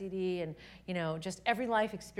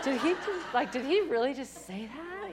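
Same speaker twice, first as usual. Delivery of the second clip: high, stunned whisper-free gasp of disbelief; incredulous disbelief; 4 semitones higher, 3 dB louder than usual. Retold or imitated. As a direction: ask it as if you can't believe your ears. Everyone stunned at the table.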